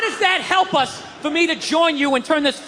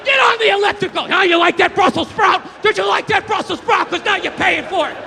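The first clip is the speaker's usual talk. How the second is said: raised, heated scolding; angry berating; 5 semitones higher, 3 dB louder than usual. Acted. Chef to client who hates Brussels sprouts, angry berating. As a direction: berate them like a furious trainer.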